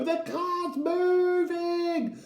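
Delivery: put-on high, long-drawn child's scream. A narrator's reading. Eddie in the rolling car, high screaming. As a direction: scream it slowly, stretching every word. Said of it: "Eddie was screaming out the window".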